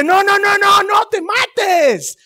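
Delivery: high, loud, slowing desperate yell; shouting to stop him. Retold or imitated. Pablo shouting at the jailer not to kill himself. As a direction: cry out to stop the sword. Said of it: "Pablo le grita".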